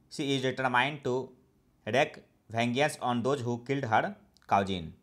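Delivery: each English word read out very slowly and evenly; slow dictation reading. Shown as a demonstration. Teacher reading an example sentence to learners, slow and deliberate. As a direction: read it slowly, as if for students to write down.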